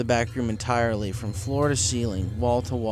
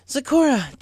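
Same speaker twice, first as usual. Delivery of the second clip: high loud call across the forest; urgent shout of the name; 7 dB louder, slower than usual. Acted. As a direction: call her from a distance. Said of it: "Twilight called".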